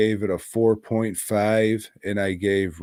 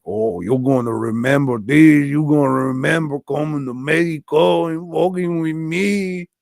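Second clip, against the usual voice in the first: loud, higher-pitched caricature Mexican villain; mock Mexican accent; 5 semitones higher, 5 dB louder than usual. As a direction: ham up a threatening Mexican accent, rising as it goes. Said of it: "do a highly offensive accent".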